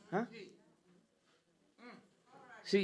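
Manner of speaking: usual speaking voice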